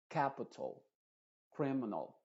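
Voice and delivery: "capital" y "criminal" con la última vocal reducida; schwa final. Shown as a demonstration. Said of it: "sino que es una schwa". ("capital" y "criminal" con schwa al final)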